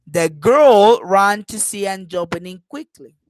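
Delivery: put-on "girl" loud and stretched, the rest lighter; stress on GIRL. Shown as a demonstration. Teacher contrasting that girl with others, GIRL stressed. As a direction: punch and lengthen "girl", then ease off. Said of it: "I am stressing girl".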